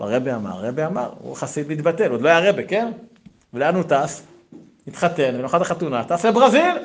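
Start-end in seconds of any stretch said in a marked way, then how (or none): 6.0-6.8 high, loud punchline with laughter; amused exclamation. Real, not acted. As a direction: land the absurd twist, laughing. Comic punchline of the wedding story.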